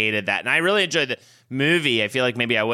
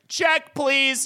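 much higher, a squeaky "Check, please."; high panicked quip; 13 semitones higher than usual. Retold or imitated. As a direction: squeak it out like you want to escape. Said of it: "And I was just like".

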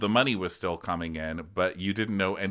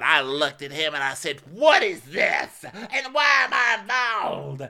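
high, slow, louder theatrical wonder; mock Erica; 8 semitones higher, 4 dB louder, slower than usual. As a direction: play innocent shock, dragging the words. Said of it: "she said".

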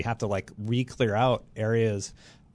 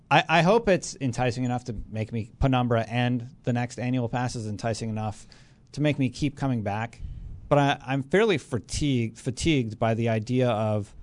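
normal talking voice

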